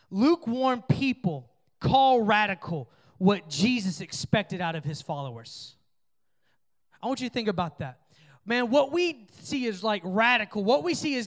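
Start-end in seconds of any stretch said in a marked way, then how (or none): 0.1-5.8 slow, weighty quote reading; read quote slowly. Shown as a demonstration. Youth pastor reading a quote to students, slow and weighty. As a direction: let each word land. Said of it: "This quote says this".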